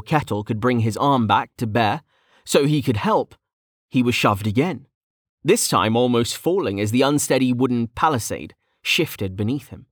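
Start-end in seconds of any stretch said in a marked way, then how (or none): none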